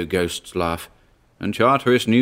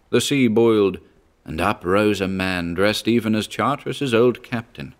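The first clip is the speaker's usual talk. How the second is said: ordinary delivery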